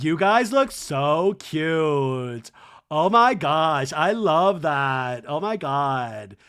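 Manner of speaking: slow, loud, stretched-out gushing; Lisa. Retold or imitated. Lisa admiring her costumed friends, slow hammed-up gushing. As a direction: drag every compliment out in mock adoration. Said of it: "And Lisa's like".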